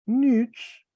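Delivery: nips said high with a falling stress; acute accent. Shown as a demonstration. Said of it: "acento agudo". (nips, con acento agudo)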